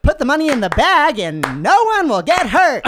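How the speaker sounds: loud, high singer impression. Guest improvising a robber's answer in the game, hollered impression. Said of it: "an impersonation of [name]".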